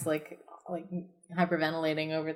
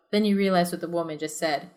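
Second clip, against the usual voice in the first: normal talking voice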